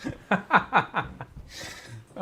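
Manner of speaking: high, wheezy run of laughter; helpless laugh. Real, not acted. Host cracking up after claiming the prize, helpless laugh. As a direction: crack up at your own joke.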